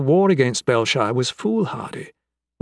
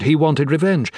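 ordinary delivery, nothing marked

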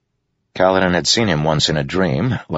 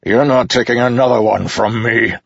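higher, forceful battle vow; defiant; 7 semitones higher, 4 dB louder than usual. A narrator's reading. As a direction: hurl it at the enemy as you charge. Dalinar defies assassin before charging.

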